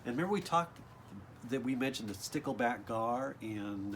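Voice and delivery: ordinary delivery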